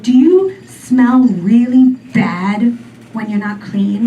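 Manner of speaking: normal talking voice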